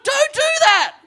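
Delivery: high, loud panicked protest; mock-frantic begging; hammed-up high panic; panicked Gideon. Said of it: "this Gideon's like".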